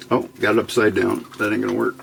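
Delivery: usual speaking voice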